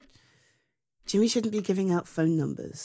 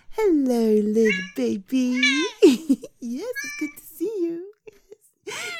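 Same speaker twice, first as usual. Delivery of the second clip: put-on baby talk to a pet; 9 semitones higher, 4 dB louder than usual. Greeting the cat fondly.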